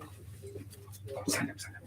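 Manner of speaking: soft whispered urging; whispering. Real, not acted. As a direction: whisper it wearily.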